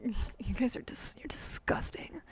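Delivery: whispered mock scolding; whispered dom tease; teasing whisper.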